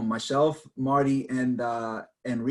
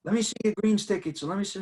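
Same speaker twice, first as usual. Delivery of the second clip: officious steward; 8 semitones higher, faster than usual. Retold or imitated. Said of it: "They're like".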